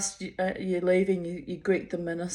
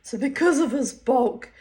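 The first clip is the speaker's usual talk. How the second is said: ordinary talk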